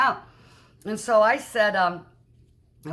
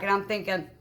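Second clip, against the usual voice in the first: ordinary delivery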